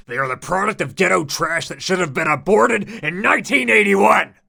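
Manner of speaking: high, building to a loud yell; ranting outburst. A narrator's reading. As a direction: spit it out in rising disgust. Narrator's rant rising to outburst.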